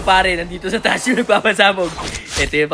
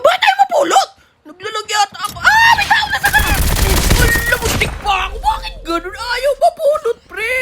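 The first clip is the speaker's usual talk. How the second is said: frantic high yelling; 12 semitones higher than usual. Real, not acted. Frantic, item won't pick up.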